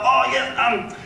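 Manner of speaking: very high, drawn-out affected rich man's voice; Philip Harding, forgetful client. Retold or imitated. Homeowner has forgotten the agent, high and affectedly dismissive. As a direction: mimic a wealthy man dismissing the caller. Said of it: "he's totally forgotten who I am".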